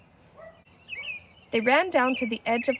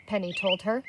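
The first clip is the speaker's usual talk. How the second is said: ordinary delivery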